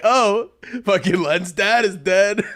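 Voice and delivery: slow quote through giggles; drawn-out punchline, barely holding laughter; laughing mock jab. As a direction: imitate the comic while cracking up. Quoting the comedian while laughing.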